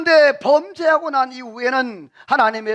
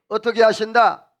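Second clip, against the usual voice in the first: normal talking voice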